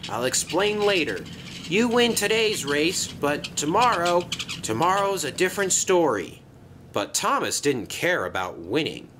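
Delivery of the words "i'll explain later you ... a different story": higher, eager character voice; hurried bus character. Acted. Bus brushing off Thomas before a race, hurried and higher. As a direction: call it out quickly to a friend.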